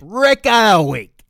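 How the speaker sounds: loud, high, drawn-out award call; big stretched-out hype call; announcer shout. Pretend. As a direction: belt it out like a game-show title. Announces the show's segment award.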